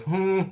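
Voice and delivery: short high-pitched "hmm"; dismissive hum. Acted. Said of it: "she goes".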